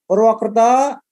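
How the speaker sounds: high, loud roll-call question. Real, not acted. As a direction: call the city out to the audience. Calling out cities to audience. (memanggil hadirin dengan lantang)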